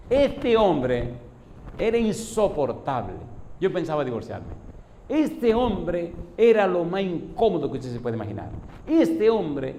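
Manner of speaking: high, loud, emphatic griping about someone; retold wife's tirade, punched phrase openings; exasperated complaint. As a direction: air a long-held grievance loudly. Wife's angry tirade about husband.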